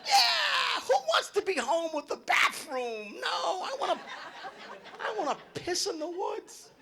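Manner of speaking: high, laughing rant; excited laughing rant. Real, not acted. Comic gleefully tells the crowd about tailgating.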